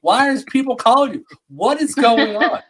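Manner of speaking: loud, high, exasperated retold scolding; going off on someone. Judge scolding a man for answering the phone, going off. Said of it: "she went off on that".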